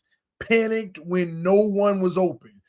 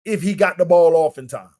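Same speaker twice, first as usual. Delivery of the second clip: usual speaking voice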